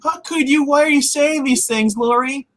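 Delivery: very high, whiny imitation; hammed-up wounded protest; mock upset. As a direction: plead in a high mock-hurt voice. Acts out upset Carol confronting Lori.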